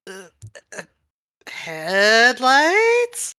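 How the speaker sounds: drawn-out questioning rise; puzzled recognition. Acted. Upside-down friend peers at Headlights, puzzled rising call. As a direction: call the name as a long, climbing question. Character sees transformed friend, unsure.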